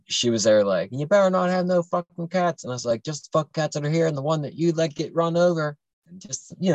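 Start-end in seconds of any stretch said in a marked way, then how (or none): 0.9-2.6 higher, sharp mock threat; mom's threat. Mom warning son about cats, angry threat. 3.0-5.8 higher, biting mock comeback; angry retort. Son snapping back at his mom, angry retort.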